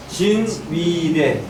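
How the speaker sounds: slow, evenly spaced recitation; syllable by syllable. Shown as a demonstration. Teacher drilling the hexagram names, syllable by syllable. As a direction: stretch out each syllable for the class. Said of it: "이렇게 있는 거예요".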